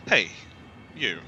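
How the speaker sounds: ordinary delivery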